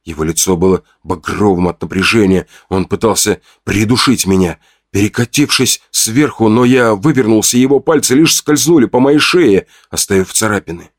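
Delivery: ordinary delivery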